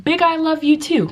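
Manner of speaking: put-on high, simplified talk for small children; reply as if to a toddler; high, playful teacher voice; teacher answering pupil. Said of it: "I'm gonna be like".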